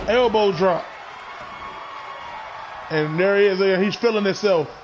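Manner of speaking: steady ringside call, punchy on each move; live play-by-play. Acted. Commentator calling the match for his viewers, play-by-play. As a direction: call every hit like a ringside announcer.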